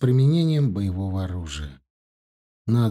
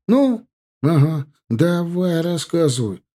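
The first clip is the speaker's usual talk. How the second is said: pleading; 7 semitones higher, 5 dB louder than usual. Acted. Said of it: "просил Дима".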